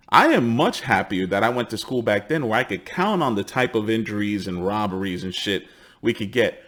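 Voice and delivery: ordinary talk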